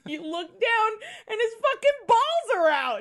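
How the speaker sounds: high, loud, cracking up with laughter; real hysterical disbelief, pitch shooting up; laughing outburst. Real, not acted. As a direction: blurt the punchline through rising laughter. Cracking up at scene punchline.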